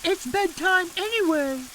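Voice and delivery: put-on brisk, sending the kids to bed; mother hurrying children inside; mother's voice. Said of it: "shouted Missus Thomas".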